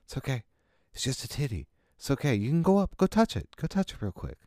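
hushed, high, coaxing soothe; calm them like a scared kid; soothing a child. Acted. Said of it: "And it's like".

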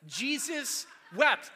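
"Jesus wept" slow and loud, both words punched; emphasizing each word. Shown as a demonstration. Preacher demonstrates memorizing to the room, emphatic. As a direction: hit "Jesus" and "wept" hard, slowly. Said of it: "Alternate emphasizing each word".

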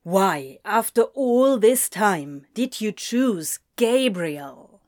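slow, pressing, accusing question; tense accusation. A narrator's reading. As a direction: demand an answer, leaning on the words. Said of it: "wound tense like a snake".

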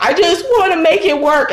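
high, tearful-ex imitation; mock crying. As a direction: imitate an ex whining down the phone. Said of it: "call me and cry and be like".

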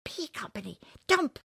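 high-pitched little squeal; baby rabbit squeal. Acted. Baby rabbit joins the joke, squealing. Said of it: "squealed a baby rabbit".

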